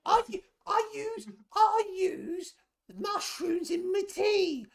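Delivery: a cranky little goblin snapping back; exasperated goblin voice. Acted. GM voices goblin potion seller.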